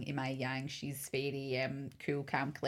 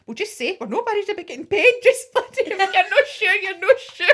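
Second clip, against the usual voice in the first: much higher and louder, half laughing; heated mock plea; 13 dB louder than usual. To Jennifer about the bride, a mock outraged higher, louder plea. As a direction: push the point with mock outrage. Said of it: "I says to Jennifer".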